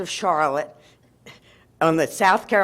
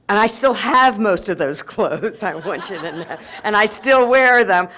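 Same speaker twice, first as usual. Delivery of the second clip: laughing through the words; chuckling while admitting she still wears them; 3 semitones higher, 4 dB louder than usual. Real, not acted. Laughing to the audience about still wearing the clothes.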